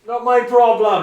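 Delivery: loud, high brush-off; dismissive. Acted. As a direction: shrug off the debt loudly. Dismissing the manager.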